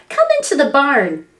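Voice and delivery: usual speaking voice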